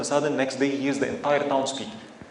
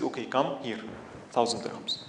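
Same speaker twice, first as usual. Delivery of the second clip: soft, quiet, calm, unbothered; calm gentle reply; 5 semitones lower, 10 dB quieter than usual. Acted. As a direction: hand the money over gently. Scholar calmly hands over money.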